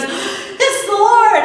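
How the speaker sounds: slow, stretched, high and loud with a laugh; dawning realization. Retold or imitated. Peter hears John and realizes who it is, slow laughing realization. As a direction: let it sink in as you say it. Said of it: "his brain engages and he goes".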